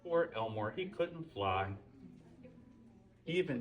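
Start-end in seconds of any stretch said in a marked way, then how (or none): none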